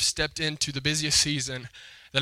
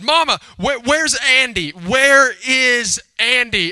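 put-on higher, louder, stretched-out child's demand; upset little girl; 8 semitones higher, 8 dB louder, slower than usual. Little girl went to her mom, mad, demanding loudly. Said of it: "So she got mad at her brother".

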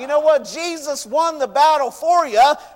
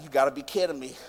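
low, subdued grumble of disbelief; quietly fed-up aside, pitched way down; disbelieving grumble; 11 semitones lower, 8 dB quieter, faster than usual. Retold or imitated. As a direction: grumble it under your breath. Imagines himself grumbling as Naaman.

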